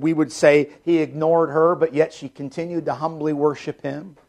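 normal talking voice

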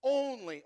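"Only" punched high and loud; emphatic only. Shown as a demonstration. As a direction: stab "Only" with emphasis, falling. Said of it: "there's emphatic".

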